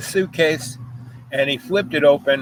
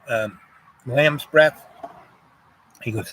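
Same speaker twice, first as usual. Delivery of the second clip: ordinary talk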